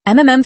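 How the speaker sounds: pained groan through closed lips; muffled groan. Acted. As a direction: hold back a groan of pain. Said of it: "I let out a muffled groan".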